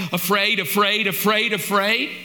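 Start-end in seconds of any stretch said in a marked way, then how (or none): none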